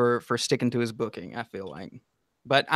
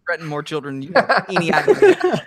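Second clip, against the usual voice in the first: mock threat; 4 semitones higher, 5 dB louder than usual. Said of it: "And I was like".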